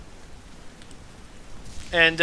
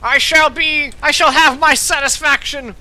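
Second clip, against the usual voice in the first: higher and louder parting threat; vengeful shout; 9 semitones higher, 5 dB louder than usual. Acted. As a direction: bellow it as he's dragged off. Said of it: "he shouts".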